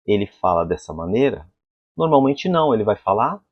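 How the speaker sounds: normal talking voice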